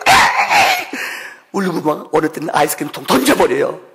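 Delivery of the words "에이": put-on high, loud childish "에이!" yell; mock shout. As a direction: shout like a sulky kid snatching food. Son grabbing ice cream, shout. (떼쓰는 아이 고함)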